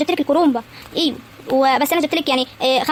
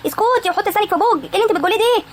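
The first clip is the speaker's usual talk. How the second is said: higher-pitched telling-off; indignant scolding; 7 semitones higher than usual. Acted. Woman defending her helper from criticism, indignant scolding. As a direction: cut her off with raised pitch.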